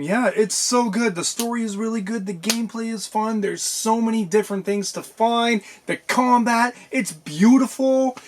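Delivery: high-pitched, excited rave; gushing. Real, not acted. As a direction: gush about it, pitched well up. Gushing about a favourite game.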